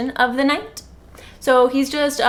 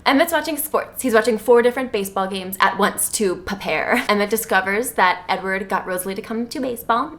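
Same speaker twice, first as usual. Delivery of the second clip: ordinary delivery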